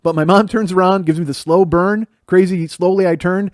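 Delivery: ordinary talk, nothing marked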